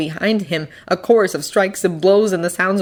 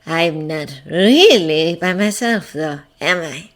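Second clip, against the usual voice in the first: slow, teasing villain line; cryptic; 2 semitones lower, slower than usual. A narrator's reading. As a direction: draw it out knowingly. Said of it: "he said cryptically".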